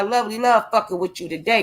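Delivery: ordinary talk, nothing marked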